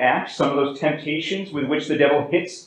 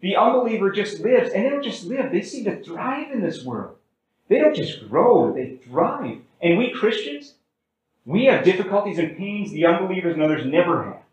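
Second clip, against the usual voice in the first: higher-pitched mock complaint; complaining; 5 semitones higher than usual. Psalmist David complains to God about unbelievers, complaining. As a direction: plead the grievance with a raised, swooping pitch. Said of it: "David complains about this to God".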